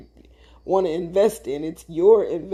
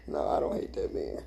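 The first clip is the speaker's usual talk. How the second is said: whisper; 9 dB quieter than usual. Real, not acted. Joking denial about ex-husband.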